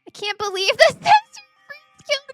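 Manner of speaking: high, loud and laughing, can't believe it; a real outburst of high, loud laughing disbelief. Real, not acted. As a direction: blurt it out half laughing in disbelief.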